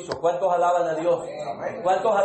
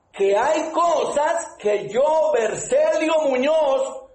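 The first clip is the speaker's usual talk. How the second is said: slow preaching; 3 semitones higher, slower than usual. Acted. Preacher driving home his point to the church, slow and fervent. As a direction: lean into each word for the congregation.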